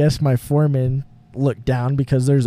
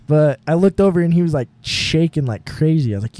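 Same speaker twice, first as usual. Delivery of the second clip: ordinary talk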